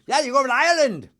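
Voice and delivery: bad Irish accent. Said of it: "that didn't sound anything like I-, like an Irish accent".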